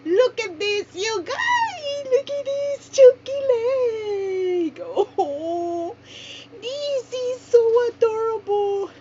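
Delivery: squealing with delight; excited squeal. Real, not acted. Unboxing long-awaited baby doll.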